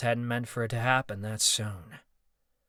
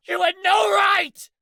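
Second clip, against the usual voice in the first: much higher, louder raging shout; furious shout; 10 dB louder than usual. Acted. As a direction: hurl it at him in fury. Marcus raging on.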